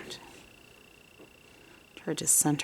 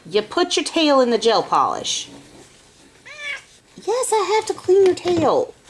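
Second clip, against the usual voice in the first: pet talk; 12 semitones higher, 7 dB louder than usual. Real, not acted. Talking to the cat.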